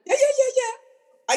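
high, laughing yeah yeah; amused at her own quick temper, laughing; laughing agreement. Real, not acted.

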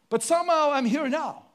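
usual speaking voice

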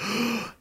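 short, high startled gasp; imitated gasp. Host acting out viewers' shock at the reveal, mock gasp. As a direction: suck in a quick mock-shocked breath. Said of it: "you're kinda like".

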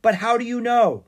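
loud, high, amazed question; astonished cry. A narrator's reading. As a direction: blurt it out in wonder, pitched well up. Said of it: "Stanley cried in astonishment".

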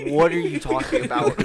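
high, loud incredulous question; mock bewilderment. Friend baffled by the English accents, mock incredulous cry. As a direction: exclaim it in comic exasperation. Retelling own bewildered reaction.

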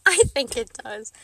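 I think it does said through a laugh. Real, not acted. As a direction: laugh as you say it back to yourself. Repeats answer, second time laughing.

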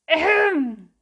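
loud pointed ahem; throat clearing. A narrator's reading. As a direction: clear throat to get noticed. Crow clears throat for attention.

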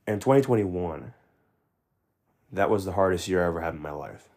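ordinary delivery

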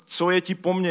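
the opening line thrown up high, then falling back; a hint of the demon crying out; loud cry. A narrator's reading. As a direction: read the possessed man's cry with a mild lift. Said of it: "řekl silným hlasem".

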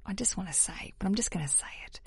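hushed unvoiced aside; whispering. Real, not acted. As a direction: whisper it like a secret. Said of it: "Lots of weird whispering today".

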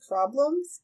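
normal talking voice

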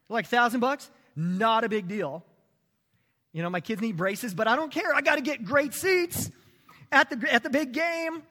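high, loud mock of a careless sports fan; a hammed-up fan shrugging off his kids' braces; selfish football fan. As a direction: play the dad who'd skip braces for seats.

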